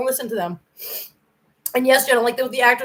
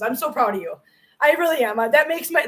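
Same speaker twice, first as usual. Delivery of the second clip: normal talking voice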